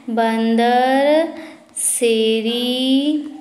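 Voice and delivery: each word drawn out slowly; teacher making students repeat the capital slowly. Shown as a demonstration. Stretched slow syllables. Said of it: "क्या है?".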